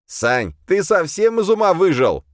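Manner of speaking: high, loud disbelieving demon voice; the demon can't believe his folly; incredulous demon. A narrator's reading. (высокий голос, недоумение)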